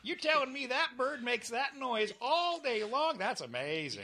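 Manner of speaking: high-pitched, slow wonder that drops on the last words; hammed-up fascination, dragging out the question; exaggerated amazement. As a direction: act utterly amazed by something dull. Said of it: "You could make anything interesting".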